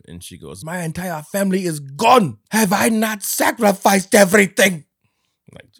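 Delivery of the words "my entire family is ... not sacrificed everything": loud, high, drawn-out mock fury; pissed-off queen. Queen raging about her lost family, hammed-up fury. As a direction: thunder it slowly like an outraged monarch. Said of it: "that pisses the queen off".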